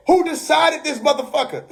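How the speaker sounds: half-laughing mock outrage; mock yell. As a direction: imitate a streamer yelling at the screen. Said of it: "yelling at the screen".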